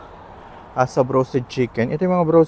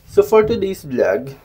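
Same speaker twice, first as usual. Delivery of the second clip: excited greeting; 6 semitones higher, 7 dB louder, slower than usual. Real, not acted. Vlog opening greeting.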